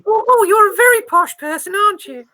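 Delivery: put-on high, reedy old lady; old granny voice. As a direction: play a nosy old granny. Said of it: "my old granny voice".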